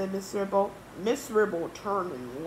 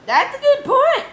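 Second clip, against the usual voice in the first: high, loud character voice; Miss Anthrope convinced; 17 dB louder than usual. Acted. Miss Anthrope agreeing with George, high and loud. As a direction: pipe up loudly in agreement.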